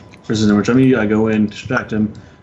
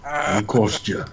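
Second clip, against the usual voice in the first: slow, tentative Russian accent; Russian accent try; 4 dB quieter, slower than usual. Shown as a demonstration. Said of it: "talk like this".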